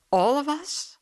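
"All of us?" high and rising to "us"; questioning. A narrator's reading. Beggar querying the householder's advice, pointedly questioning. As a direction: climb through "All of us?" as a query. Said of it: "I queried".

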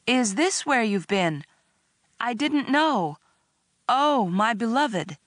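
high-pitched, stagy lover's dismay; badly faked horror. A narrator's reading. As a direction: overact a prince's concern unconvincingly. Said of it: "supposed to be a horrified tone, but only came out sounding wooden".

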